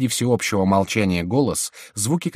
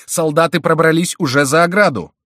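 shouted alarm; 9 semitones higher, 8 dB louder than usual. A narrator's reading. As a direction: shout the alarm across the room. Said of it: "закричал Фулон". (тревожный крик)

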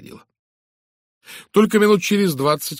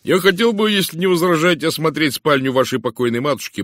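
raised, louder, energetic request; lively, animated; 7 semitones higher, 7 dB louder than usual. A narrator's reading. Inspector asks to see the bedroom, animatedly. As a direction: open high and full of energy. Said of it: "оживлённо сказал Джеб".

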